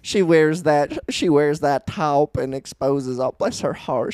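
slow, sweet Southern backhanded gossip; southern accent. Retold or imitated. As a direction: purr a Southern put-down with a smile. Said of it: "if you got that accent".

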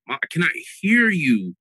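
loud, high mock plea; exasperated plea. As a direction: raise the voice and stretch the plea out. Son pleading with too-quiet mother.

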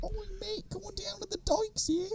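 high whispered mock Cape Breton accent. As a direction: tease him in a breathless whispered Cape Breton voice. Mimicking the Cape Breton guy.

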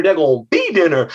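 ordinary delivery, nothing marked

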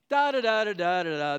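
blithely da-da along, hammed up; mock oblivious. Preacher playing a naive person heading for a cliff, mock oblivious.

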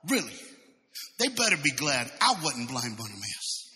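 ordinary talk

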